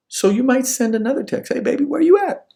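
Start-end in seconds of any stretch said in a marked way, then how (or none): 1.5-2.5 high, loud, pleading call to a sweetheart; anxious texter. Acted. Acted worried text to girlfriend.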